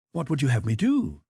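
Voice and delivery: light irony. A narrator's reading. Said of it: "with the same light irony".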